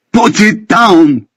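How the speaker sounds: high, slow, drawn-out scream; desperate scream. A narrator's reading. Benjamin screaming at Ricky to put the javelin down. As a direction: drag out every word in a scream.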